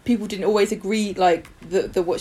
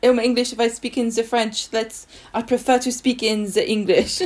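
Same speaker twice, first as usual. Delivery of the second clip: imitating Irma's put-on French accent; Irma's fake French accent; 5 semitones higher than usual. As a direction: ham up a French woman's English. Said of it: "And Irma's like".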